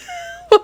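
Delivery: high-pitched squeaky pretend sob; hammed-up crying noise, half laughing; mock crying. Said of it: "while crying".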